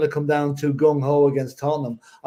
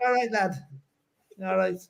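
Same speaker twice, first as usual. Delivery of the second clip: higher, soft mock Scouse accent; light Scouse caricature, pitched up; Harry Enfield Scouse; 3 semitones higher, 7 dB quieter than usual. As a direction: put on a comic Scouser accent. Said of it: "do the Harry Enfield accent as well".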